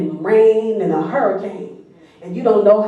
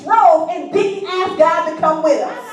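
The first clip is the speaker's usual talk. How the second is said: much higher, building preacher's cry; preaching at full force; 10 semitones higher than usual. Acted. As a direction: drive the sermon up to a climax.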